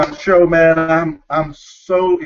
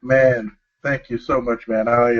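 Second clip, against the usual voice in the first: ordinary talk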